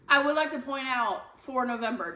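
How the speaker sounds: ordinary talk, nothing marked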